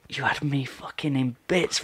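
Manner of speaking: hushed, emotional confession; whispered. Retold or imitated. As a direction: whisper it, still moved. Teacher after crying.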